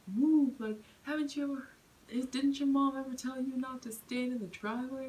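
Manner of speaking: retold shout at the girl by the door; imitating yelling man. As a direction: bark it like the angry stranger. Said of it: "this guy came on the train yelling".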